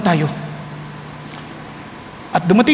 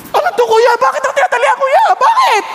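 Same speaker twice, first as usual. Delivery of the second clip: high-pitched frantic questioning; hammed-up victim's wail; panicked pleading; 12 semitones higher than usual. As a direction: cry out in bewildered alarm. Joseph acted, being tied up and sold.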